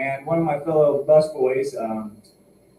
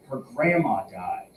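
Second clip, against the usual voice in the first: ordinary delivery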